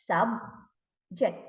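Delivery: "subject" split as sub, ject with a gap; syllable by syllable. Shown as a demonstration. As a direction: break "subject" into two slow beats. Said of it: "how many syllables".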